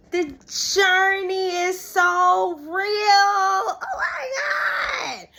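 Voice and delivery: real crying shout, high and drawn out; sobbing oh my God, very high and slow. Real, not acted. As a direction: break down into a crying wail. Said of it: "I'm just crying".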